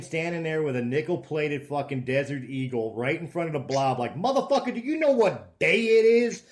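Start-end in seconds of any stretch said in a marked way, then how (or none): 4.2-6.4 high, loud, hammed-up badass movie threat; Samuel L. Jackson. Imagined movie hero taunting The Blob, hammed-up high threat. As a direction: ham up a tough-guy movie star staring down a monster. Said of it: "Sam, Sam L".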